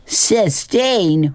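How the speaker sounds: high-pitched, incredulous echo of the name; mocking hoot. Billy makes fun of the girl's name, mocking hoot. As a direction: hoot the name back in ridicule. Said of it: "hooted Billy".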